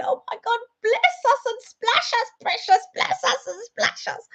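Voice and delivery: very high, squealing comic outcry; hammed-up squeal under a shower of ice; mock panic. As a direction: play up the silly high-pitched fuss. Acting out ice falling on her.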